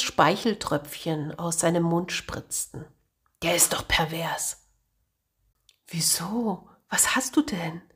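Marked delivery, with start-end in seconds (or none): 3.4-4.7 hushed. Acted. Martin hisses about rival. 5.9-7.8 whisper. Acted. Angel whispers back, puzzled.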